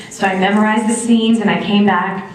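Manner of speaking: normal talking voice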